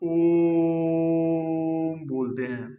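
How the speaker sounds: ओम drawn out long and steady; prolonged om. Shown as a demonstration. Teacher demonstrating a pluta vowel, ओम stretched long. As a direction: hold the ओम like a chant. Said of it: "प्लुत स्वर जैसे हम".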